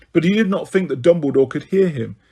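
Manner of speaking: ordinary delivery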